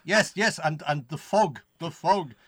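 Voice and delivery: hammed-up Scottish doom; ominous warning in a Scottish burr; Scottish accent. Playing along about the fog, in dramatic Scottish. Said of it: "do it all in Scottish".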